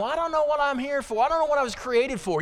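high-pitched mock bewilderment; aimless people whining. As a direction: imitate clueless people going around lost. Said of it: "People are all going around".

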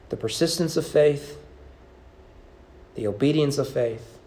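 ordinary talk, nothing marked

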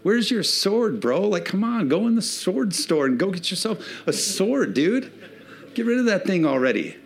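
a high, teasing voice with a laugh in it; mocking townspeople. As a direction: taunt the shepherd boy like a smug bro. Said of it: "and they're going".

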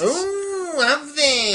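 high, delighted ooh; excited squeal. Real, not acted. Excited reaction to singing news.

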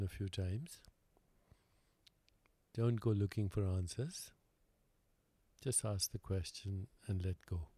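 calm, unhurried meditation guide with long pauses; guided meditation. Real, not acted. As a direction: lead them gently inward, leaving silence between phrases.

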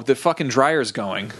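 ordinary talk

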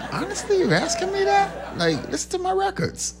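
high-pitched playful indignation; mock offended. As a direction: protest as if insulted, half laughing. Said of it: "And I'd be offended".

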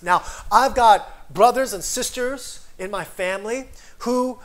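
loud, aggrieved rant; a bitter man's loud complaint. Retold or imitated. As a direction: vent like a wronged man. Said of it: "has got a bit of an attitude".

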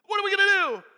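high, loud panicked question; freaking out. Acted. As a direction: demand an answer in acted panic. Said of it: "He's freaking out".

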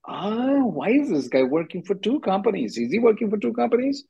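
high, questioning mock confusion; puzzled officer. Immigration attorney voicing a confused officer, mock puzzled. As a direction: play the baffled reviewer squinting at the form.

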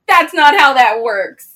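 loud, slow, firm retort; emphatic correction. Acted. Co-host answering Steve's boast, emphatic correction. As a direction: space it out loudly to set him straight.

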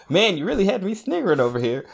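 Steve heckling. Acted. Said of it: "And then Steve goes".

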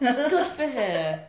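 slow, high, laughing gush; laughing mock praise. Friend keeps mocking the praise, laughing. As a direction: drag it out through a laugh.

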